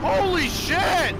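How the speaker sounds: high, slow stunned exclamation; amazed. Real, not acted. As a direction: gasp it out in disbelief. Friend amazed.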